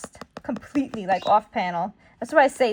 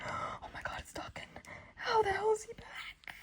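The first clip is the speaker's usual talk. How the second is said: shocked whisper; 10 semitones higher, 16 dB quieter than usual. Acted. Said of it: "He's like".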